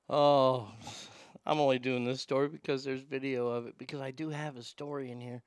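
ordinary talk, nothing marked

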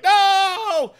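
very high, loud drawn-out No; anguished scream. Retold or imitated. Spider-Man crying out as the villain taunts him, high loud wail. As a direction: wail it in despair. Hero's despair at Mary Jane.

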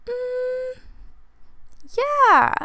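drawn-out, very high-pitched hum into yeah; hesitant yes. Retold or imitated. Girl answers her crush's girlfriend question, hesitant high-pitched yes. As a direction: squeak out a reluctant, high yes. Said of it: "I literally said it just like that".

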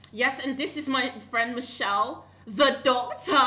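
high, showy mock introduction; hyping up friend. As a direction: present your friend like a celebrity. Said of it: "I'm just like".

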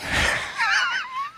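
shrieking laugh. Real, not acted.

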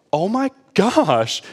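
slow, high, drawn-out astonishment; acted amazement. Hearing granny foretold it at twelve, acted astonishment.

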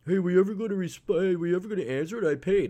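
mock a pushy client; impatient customer. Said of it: "I've had people email me months later".

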